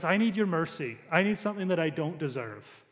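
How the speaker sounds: normal talking voice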